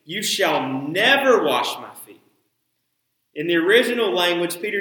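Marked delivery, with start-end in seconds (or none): 0.0-2.2 loud protest with NEVER punched; exclaiming in shock; exclaimed. A narrator's reading. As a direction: Peter refusing out loud. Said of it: "he exclaimed".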